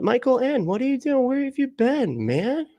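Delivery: light up and call out to a regular who's been away; greeting a long-missing chatter, high and delighted; excited greeting. Real, not acted.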